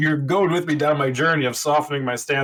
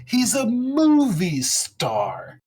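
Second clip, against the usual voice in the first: retold comedian's rant; 9 semitones higher than usual. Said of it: "he does a bit about Sean Connery".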